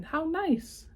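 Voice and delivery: high, delighted little egg; Humpty thrilled with his gift. Acted.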